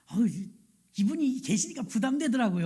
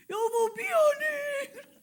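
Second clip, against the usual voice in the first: very high, drawn-out mock wail; hammed-up tearjerker husband sobbing to his wife; melodramatic weeping apology; much slower than usual. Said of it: "심파극을 찍어요". (신파극처럼 울부짖는 사과 흉내)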